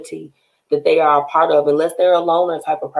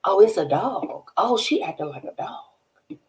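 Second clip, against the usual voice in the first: slow, hushed, puzzled double take; soft and slow, working it out; retold disbelief; 5 semitones higher, 6 dB quieter, slower than usual. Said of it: "I was like".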